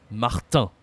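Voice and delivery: "Martin" ending on a nasal vowel, n silent; nasal French ending. Shown as a demonstration. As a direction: let "Martin" end through the nose. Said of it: "a nasal".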